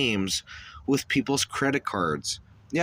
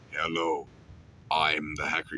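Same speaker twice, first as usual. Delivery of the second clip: much lower, menacing hacker voice; a lowered, disguised voice for a creepy message; deep hacker voice; 8 semitones lower, 6 dB quieter than usual. Retold or imitated. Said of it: "you record a voice message saying".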